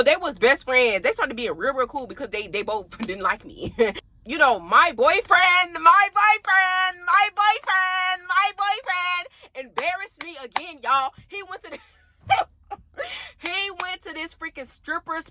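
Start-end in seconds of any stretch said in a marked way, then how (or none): 4.6-10.8 drawn-out high mock whine over the boyfriend; mock dramatic wail. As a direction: stretch each "my boyfriend" like a hammed-up lament. Dramatic lament over embarrassing boyfriend.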